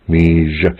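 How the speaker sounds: "Mi Je" with a hushing zh in "Je"; "Mi Je", sh-like and not z; sh sound. Shown as a demonstration. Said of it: "this is a sh sound".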